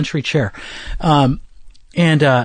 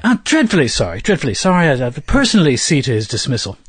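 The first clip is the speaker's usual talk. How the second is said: put-on apologetic British accent, pitched up a bit. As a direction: grovel politely in a mock British accent. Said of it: "that's my British accent".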